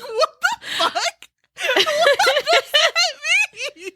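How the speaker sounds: high, laughing incredulous squeal; laughing retold shock; mock disbelief. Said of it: "I was like".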